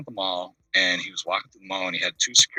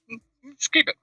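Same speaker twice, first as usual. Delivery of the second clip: imitating a rock singer; 3 semitones higher than usual. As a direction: pitch the greeting way up like a famous singer. Rock singer gives guitar away.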